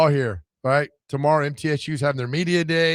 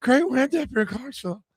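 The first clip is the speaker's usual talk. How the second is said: much higher, cheery coach voice; imitating the coach; 11 semitones higher than usual. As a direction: put on a chirpy coach greeting. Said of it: "He's like".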